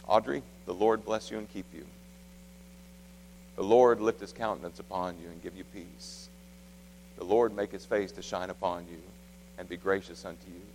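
low, soft and solemn; tender blessing. Retold or imitated. Father blessing his daughter at bedtime, tender and hushed.

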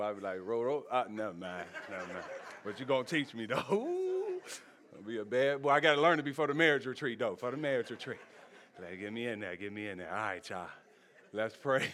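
ordinary delivery

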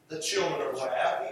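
ordinary talk

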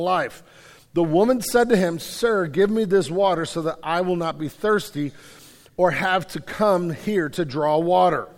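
loud, measured Bible reading from the pulpit; scripture reading. Acted. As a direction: read the Gospel passage with weight, like a preacher at the lectern. Reads John 4 aloud.

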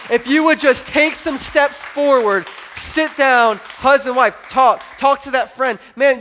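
loud, high preaching push; preaching hard. Acted. Pastor urging the church to talk it out, preaching loud. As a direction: drive it home to the congregation.

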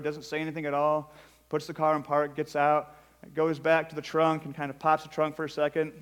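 ordinary delivery

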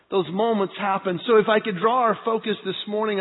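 ordinary talk, nothing marked

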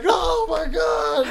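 high, drawn-out mock wail; imitated crying wail. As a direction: wail like a crying kid, amused. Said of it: "is crying and holding his booty".

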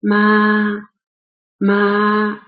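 ma held high, long and level; flat first tone. Shown as a demonstration. Teacher showing learners the highest pitch, flat tone. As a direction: sustain ma on one high, unchanging pitch. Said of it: "ma is a flat tone".

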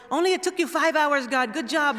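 put-on high, eager childlike praise; imitated impressed kids. Kids checking watches and praising God, imitated excited voices.

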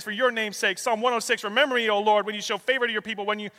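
urgent, high, hurried recitation; breathless rush. Real, not acted. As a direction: race through the verses without stopping for breath. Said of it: "I gotta catch my breath".

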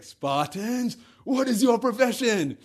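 high, loud battle cry to an army; commander's rallying shout. Acted. Leonidas calling to all his Spartans, shouted challenge. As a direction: bellow it out like a war leader. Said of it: "he says to all the Spartan armies".

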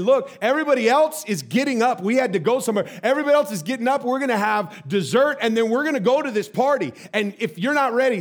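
loud, high, pressing warning; exasperated dad. Retold or imitated. Dad warning daughter dessert and party are next, exasperated.

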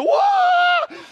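high, loud shriek of "whoa"; sudden mock-terror yell; imitated terrified scream. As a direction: scream like you've seen a ghost. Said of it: "And they scream".

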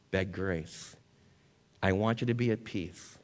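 ordinary talk

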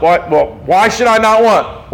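ordinary talk, nothing marked

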